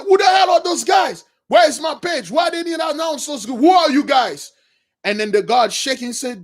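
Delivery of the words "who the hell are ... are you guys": high, loud royal bellowing; furious king. Retold or imitated. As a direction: roar at the court like an outraged king. King shouts at intruders.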